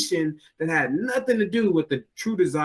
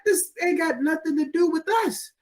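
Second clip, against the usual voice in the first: baffled high voice; 13 semitones higher than usual. Retold or imitated. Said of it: "I was like".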